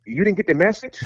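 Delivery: high retold boss question. Boss asks in retold story.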